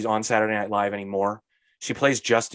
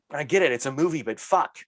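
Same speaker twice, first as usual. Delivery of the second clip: usual speaking voice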